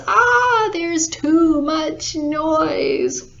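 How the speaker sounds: high, drawn-out wail of frustration; acted anguished holler, stretched slow; exasperated yell. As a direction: cry out as if going nuts. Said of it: "Farmer Bob was going nuts in bed".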